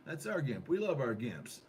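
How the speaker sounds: normal talking voice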